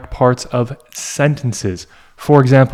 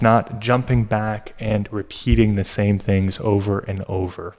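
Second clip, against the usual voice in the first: ordinary talk, nothing marked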